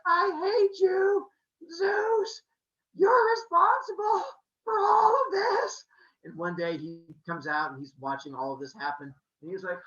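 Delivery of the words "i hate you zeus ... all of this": high, loud sobbing shout; crying yell. Acted. Leto running past Olympus, sobbing and shouting at Zeus. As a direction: wail your anger through the tears.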